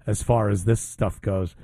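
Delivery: ordinary talk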